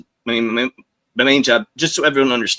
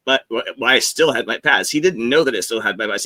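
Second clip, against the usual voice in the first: normal talking voice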